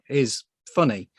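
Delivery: ordinary talk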